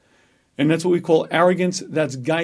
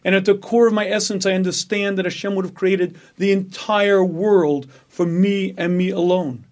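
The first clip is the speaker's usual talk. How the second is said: ordinary delivery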